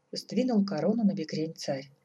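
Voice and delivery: usual speaking voice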